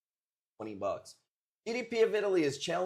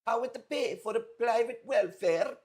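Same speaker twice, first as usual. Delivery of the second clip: much higher mock Italian accent; 8 semitones higher than usual. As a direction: imitate the viewer's broken Italian English. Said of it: "let me read it how you said it in your little Italian brain".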